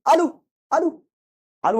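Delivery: high, called-out word; mock yell. Shown as a demonstration. Teacher demonstrates shouting at a wall, mock yell. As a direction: call it out to bounce off a wall. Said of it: "বলে চেঁচালে".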